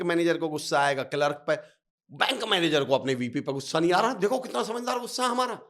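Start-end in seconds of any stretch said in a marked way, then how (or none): none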